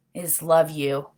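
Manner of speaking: normal talking voice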